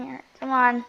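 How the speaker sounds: ordinary delivery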